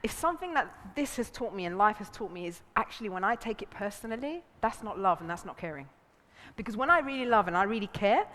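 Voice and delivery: ordinary delivery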